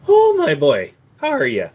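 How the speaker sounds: a cheery Irish hello; Irish voice greeting his boy; Irish accent. Acted. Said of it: "those different voices you use".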